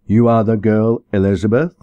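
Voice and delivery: a hint of an authoritative parson; stern minister. Minister questioning the girl with the baby, lightly stern.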